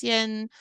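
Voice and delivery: "xian" held high and level; first-tone xian. Shown as a demonstration. Teacher showing the learner a first tone, "xian" high and level. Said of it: "xian is the first tone".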